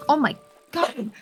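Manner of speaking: high delighted gasp into a laugh; surprised laugh. Real, not acted. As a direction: burst out in shocked laughter. Surprised by the catch.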